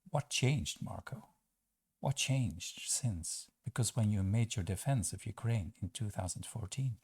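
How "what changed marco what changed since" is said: drawn out, accusing question; slow pointed question. Real, not acted. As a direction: let each word hang. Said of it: "pointed question to Marco".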